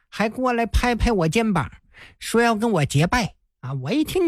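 ordinary delivery

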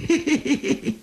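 high teasing heh-heh-heh chuckle; a high sly heh-heh laugh of an old hero. Acted. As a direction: chuckle down at him, pleased with the joke. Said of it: "蹲下身子冲蒋昭一乐".

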